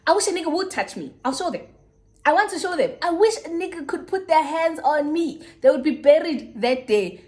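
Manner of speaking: high-pitched, boastful tough talk; big-mouth bravado. Retold or imitated. High schooler boasting to a friend about boyfriends, big-mouth bravado. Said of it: "I would be the big mouth".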